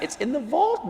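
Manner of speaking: high, hushed disbelieving question; soft, high-pitched incredulity; amazed echo. Acted. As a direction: repeat it softly in wonder, voice climbing. Echoing the concierge in amazement.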